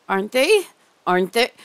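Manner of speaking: sudden loud Aren't they; insistent demand. Acted. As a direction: snap it out, demanding agreement. Pressing captive to agree.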